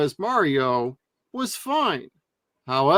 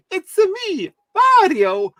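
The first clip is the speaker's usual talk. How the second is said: high Italian-American Mario impression; 6 dB louder than usual. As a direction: chirp it like the cartoon plumber. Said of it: "the kitschy, stereotypical voice".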